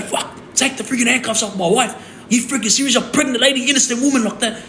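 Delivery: high, indignant protest without full volume; retold yell. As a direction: re-enact yelling at a cop to free your wife. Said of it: "as soon as I started yelling".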